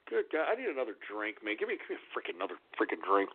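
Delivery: low, soft exasperated muttering; weary grumble. Real, not acted. Exasperated, asks for a drink.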